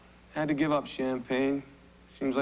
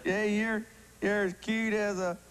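hammed-up hillbilly country accent, higher; cornball country accent; 4 semitones higher than usual. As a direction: lay the country accent on thick. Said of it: "you're doing this cornball accent for".

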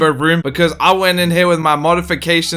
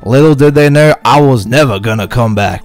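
dramatic narrator; 4 semitones lower, 4 dB louder than usual. Acted.

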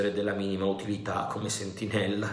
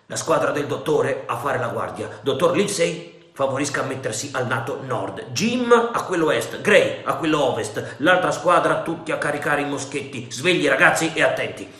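louder, brisk orders to the crew; commanding; 4 semitones higher, 6 dB louder than usual. A narrator's reading. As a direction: bark out the watch orders. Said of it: "rivolto a noi comandò".

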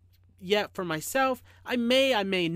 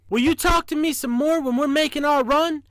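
high, louder, hopeful engineer; raised storybook enthusiasm; eager; 7 semitones higher, 7 dB louder than usual. A narrator's reading. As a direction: ask it eagerly, voice lifted. Engineer eagerly asks train to talk.

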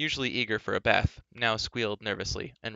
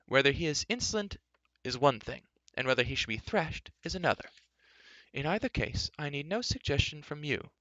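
put-on higher-pitched, measured old man's voice; unperturbed old enchanter; 6 semitones higher than usual. Old enchanter rebuffing an angry prince, unperturbed. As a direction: reason it out coolly, voice lifted. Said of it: "unperturbed by Elidyr's furious expression".

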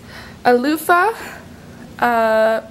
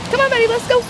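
high sing-song call to the dog; high pet-talk voice; 13 semitones higher, faster than usual. Real, not acted. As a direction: coax the dog along brightly. Calling the dog again.

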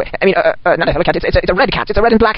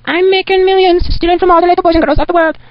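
gloating villain boast; 10 semitones higher than usual. Acted. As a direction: savour the evil boast up high.

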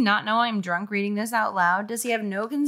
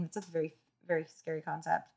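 ordinary delivery, nothing marked

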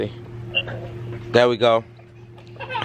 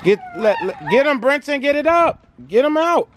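raised, higher call across the yard; 12 semitones higher than usual. Real, not acted. Dad calling out to kids across the yard, raised and higher.

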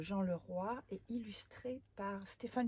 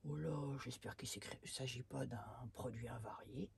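worried mutter; 7 semitones lower, 7 dB quieter than usual. Acted. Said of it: "s'inquiète le géant".

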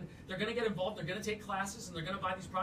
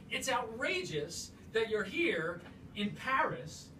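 ordinary talk, nothing marked

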